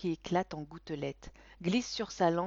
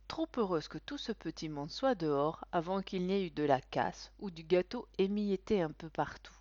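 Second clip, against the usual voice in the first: ordinary delivery, nothing marked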